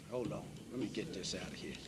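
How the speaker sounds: a hushed, quick word to himself; quiet aside. Real, not acted. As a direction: drop the voice and hurry through it, off to the side. Muttered aside while moving exhibit.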